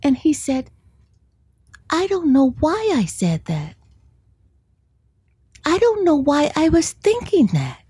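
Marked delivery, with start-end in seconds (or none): 1.9-7.8 lightly voiced child, puzzled and sorry; sad little boy. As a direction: son looking up at mom with a sad face, understated. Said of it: "with this really sad face".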